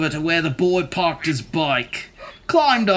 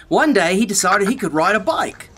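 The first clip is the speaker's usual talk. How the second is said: plain reading voice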